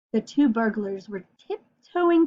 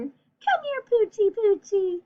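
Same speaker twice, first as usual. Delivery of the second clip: put-on much higher baby-talk call; cooing to a dog; 8 semitones higher, slower than usual. Burglar calls the puppy over, high coo. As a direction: coax the dog sweetly in a high voice. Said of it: "whispered the other".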